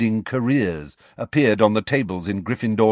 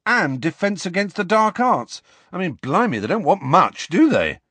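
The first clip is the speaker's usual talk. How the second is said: higher, exasperated schoolboy voice; Ron, incredulous; 7 semitones higher than usual. A narrator's reading. Ron reading career requirements to friends, incredulous. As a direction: list the demands, climbing in disbelief.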